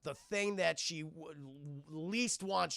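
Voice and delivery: usual speaking voice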